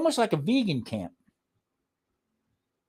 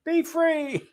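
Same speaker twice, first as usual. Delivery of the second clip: very high, hammed-up holler breaking into laughter; teasing high-pitched cry through laughs; laughing mock call to mother; 12 semitones higher, 4 dB louder, slower than usual. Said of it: "I used to tell my mother".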